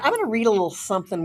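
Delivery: ordinary talk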